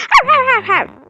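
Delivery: high, loud playful woof woof; mock dog barking. (köpek gibi tiz ve yüksek sesle havlama)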